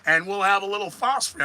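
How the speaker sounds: quick, rising mock forecast patter; weatherman. Host mimicking a weatherman giving a war forecast, hammed-up. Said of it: "like the weathermen do it".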